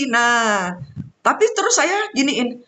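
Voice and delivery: normal talking voice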